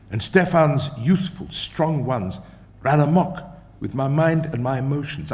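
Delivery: ordinary delivery, nothing marked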